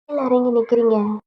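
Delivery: plain reading voice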